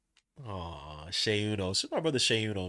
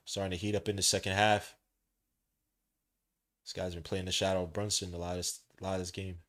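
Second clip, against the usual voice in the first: play-by-play; 3 semitones lower, 4 dB louder than usual. Acted. Streamer calling the Cavaliers game for chat, play-by-play. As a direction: call each move as it happens.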